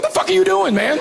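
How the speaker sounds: high-pitched, appalled outburst; mock alarm. Firefox user catches someone opening Safari, mock alarmed. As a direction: react in hammed-up horror.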